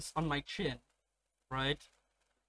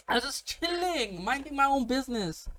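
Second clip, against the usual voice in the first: playful protest of innocence; 7 semitones higher than usual. Retold or imitated. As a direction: stretch it out, higher, all innocence. Protesting his innocence in story.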